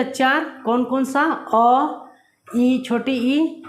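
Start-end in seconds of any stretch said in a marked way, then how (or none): 1.5-3.6 slow vowel demo. Shown as a demonstration. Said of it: "teacher naming short vowels".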